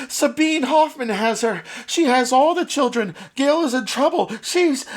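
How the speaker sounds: high, loud frantic outburst; exploding in panic. Acted. Holly panicking to her father about Gail. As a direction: blurt it all out in a rush. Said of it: "Holly exploded".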